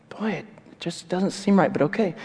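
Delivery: lightly voiced uneasy old father; doubtful father. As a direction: give in despite suspicion.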